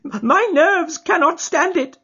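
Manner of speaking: high, loud, slow, near tears; a terrified man close to blubbering; half blubbering with fear. Acted. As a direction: whimper it out, nerves gone. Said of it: "half blubbering with fear".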